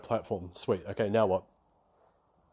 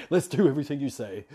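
laughing; 3 semitones higher, 5 dB louder than usual. Retold or imitated. Mock giving in to mentor.

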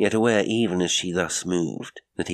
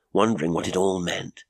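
ordinary delivery, nothing marked

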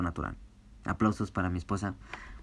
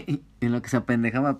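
normal talking voice

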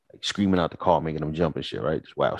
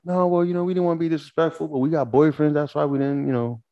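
high, quick, lightly voiced girl; politely turning him down, pitched up; imitating the women; 7 semitones higher than usual. The women explaining they have boyfriends, high and polite. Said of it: "they was like".

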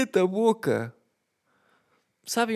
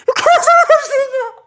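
very high, loud cry half-laughing; laughing exasperated yell; 9 dB louder than usual. Retold or imitated. Son yelling at father that he doesn't care about the neighbour, laughing shout. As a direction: shriek it in disbelief.

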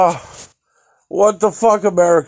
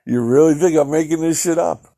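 normal talking voice

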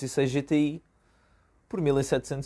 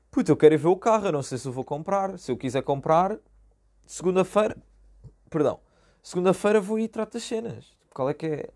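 stressed; 5 semitones higher, 4 dB louder than usual. Retold or imitated. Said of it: "Pá, já estava bué estressado".

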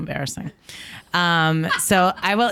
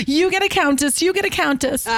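much higher, big game-show Oprah announcing; Oprah giveaway; 11 semitones higher than usual. Retold or imitated. Host doing Oprah giving every guest a countess. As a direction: shout it like Oprah handing out cars. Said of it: "You and Oprah".

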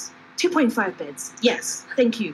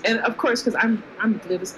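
ordinary delivery

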